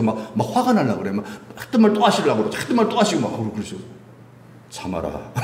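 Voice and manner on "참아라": low, soft word to oneself; =low hushed self-command; restraining self-talk. Acted. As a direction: quietly check your own temper. Acting out biting back anger.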